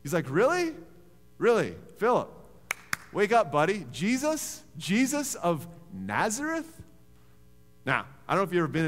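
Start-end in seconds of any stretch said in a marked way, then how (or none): 0.3-0.7 a sarcastic "Really?" that rises high and loud; a loud, rising "Really?" that mocks disbelief. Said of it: "he got sarcastic". 1.4-1.8 a flat, falling, loud "Really?"; a dry "Really?" that drops at the end; sarcastic. Retold or imitated. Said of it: "he got sarcastic". 2.0-6.8 loud, higher mocking scoff; sarcastic disbelief. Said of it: "he got sarcastic".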